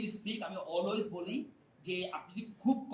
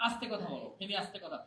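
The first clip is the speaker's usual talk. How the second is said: soft, lower self-reminder; reminder to speak softly; 4 semitones lower, 9 dB quieter, much faster than usual. Acted. Reading notes placed everywhere, softly and low. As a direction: read the note gently under your voice.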